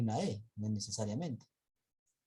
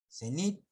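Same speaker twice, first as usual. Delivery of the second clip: cenit rising higher onto "nit"; stress on final syllable; 5 semitones higher than usual. Shown as a demonstration. Teacher giving an example word, cenit stressed on a rising "nit". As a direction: lift and hit the "nit" of cenit. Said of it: "la mayor fuerza de voz en nit".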